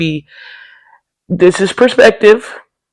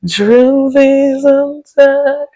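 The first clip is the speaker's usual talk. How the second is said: high, drawn-out preacher-style lament; slow, sing-song mock sermon cadence; churchy lament; 9 semitones higher, 4 dB louder, much slower than usual. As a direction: stretch it like a tired churchgoer's testimony. Weary churchy punchline.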